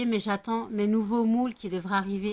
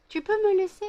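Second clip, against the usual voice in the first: much higher, louder whiny plea; exasperated high plea; 12 semitones higher, 5 dB louder than usual. Real, not acted. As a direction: squeal it at a pushy dog. Dog won't leave her alone. (supplique aiguë et exaspérée)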